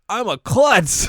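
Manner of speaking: high, loud goofy shout; blurted confession. Retold or imitated. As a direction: announce it proudly at a high pitch. Acted blurted confession of flaw.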